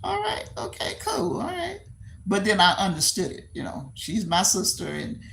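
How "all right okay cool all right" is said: high, soft puzzled what-the; bemused high mutter; taken aback. Retold or imitated. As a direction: caught off guard, lifting the pitch. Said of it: "I said".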